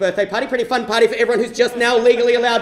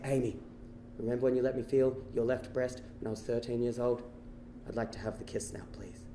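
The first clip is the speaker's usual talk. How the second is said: very low and soft, fading to a near whisper; shy, tender request; 11 semitones lower, 13 dB quieter than usual. Acted. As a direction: ask your crush gently, hushed and hopeful. Tender request to his love, retold.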